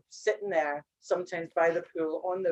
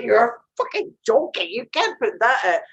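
incredulous outburst; 3 semitones higher, 6 dB louder than usual. Retold or imitated. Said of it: "I'm like".

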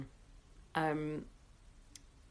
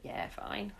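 whispered "yeah, fine"; 7 dB quieter than usual. Retold or imitated. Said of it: "So you're like".

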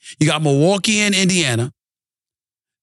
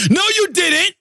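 high-pitched, exasperated hollering; incredulous sports-host tirade; indignant rant; 12 semitones higher than usual. Real, not acted. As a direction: throw their excuse back at them, voice way up. Angry rebuttal to the Knicks' front office.

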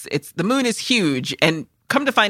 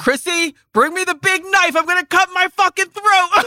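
high, loud hammed-up yell; a much higher, louder overacted cry to the coworker; mock shout; 13 semitones higher, 4 dB louder than usual. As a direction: yell at Chrissy like a melodramatic baker. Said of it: "when he shouts at Chrissy".

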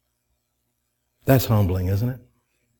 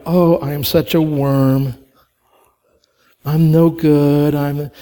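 mock self-pity; 3 dB louder, slower than usual. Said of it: "being humble is not about going".

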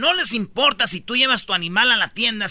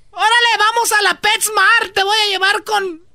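mock angry aunt; 11 semitones higher than usual. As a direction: scold him like a furious wife. Angry aunt scolding uncle.

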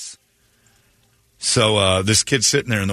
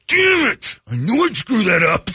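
caught-out outburst; 13 semitones higher, slower than usual. Retold or imitated. Contestant caught, acted out.

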